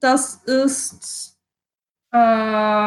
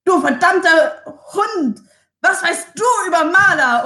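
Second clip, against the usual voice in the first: shouting; 7 semitones higher, 4 dB louder than usual. Acted. Barbarian Hildetrud charging, yelling at the shadow. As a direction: yell it with rage. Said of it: "Hildetrud ruft".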